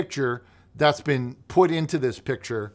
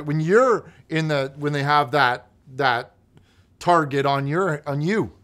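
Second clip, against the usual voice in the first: worked-up; 4 semitones higher, 7 dB louder than usual. Real, not acted. Scolding Chad over pranks.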